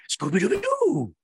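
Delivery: slow cartoon dog howl; Scooby-Doo voice. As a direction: sing it out like Scooby. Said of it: "voulu être un cartoon". (voix de Scooby-Doo)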